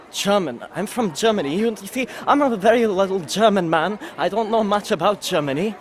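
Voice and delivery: playing a silly German man; fake German accent. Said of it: "this is my impression of a German man".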